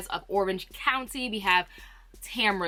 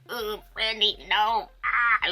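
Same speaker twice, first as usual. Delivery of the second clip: pained, cringing disapproval; cringing groan; 3 semitones higher, slower than usual. Retold or imitated. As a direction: wince at her over the top. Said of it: "I'm like".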